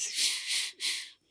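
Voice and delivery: soft whispered shushing, no voice; imitated cat whisper. Husband showing his wife how Minet whispers, hushed hissing. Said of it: "Et il recommence".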